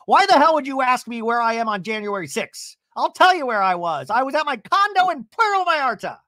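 hammed-up outrage at the question; mock indignant Vicky. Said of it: "Vicky on stage is like".

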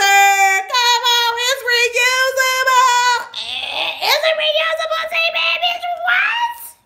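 very high, loud squealing; thrilled shout. Real, not acted. Vlogger squeals over the reusable tea bag, thrilled shout. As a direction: shriek with delight.

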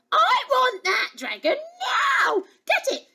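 very high, frantic princess tantrum; shrieked, bossy threat of tears; yelled demand. Acted. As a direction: yell orders like a spoilt child. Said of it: "yelled the little princess".

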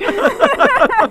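sudden high burst of laughter; loud laugh. Real, not acted. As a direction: let out a big laugh. Laughs at own joke.

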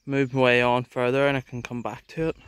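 ordinary delivery

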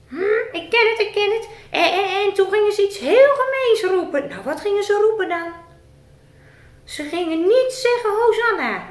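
squeaky puppet character. Acted. Puppet Rick greeting the presenter in a high voice.

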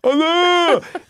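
very high, loud "oh no"; exaggerated squeal of dismay; mock dismayed wail. Acting out his embarrassed reply.

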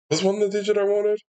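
high, quick, alarmed self-question; a sudden comic doubt; mock-alarmed. Real, not acted. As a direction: squeak it out in mock panic.

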